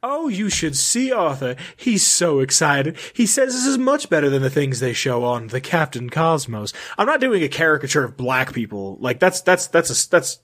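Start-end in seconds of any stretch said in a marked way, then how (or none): 0.0-6.7 fancy lady. Acted. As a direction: play a fancy lady proudly introducing her family. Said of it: "I thought you were doing a fancy lady".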